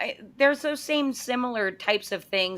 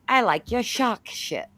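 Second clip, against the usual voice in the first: imitated Aussie accent, r's dropped; Australian accent. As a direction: mimic an Australian woman's "shark shirt". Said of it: "that's my Australian".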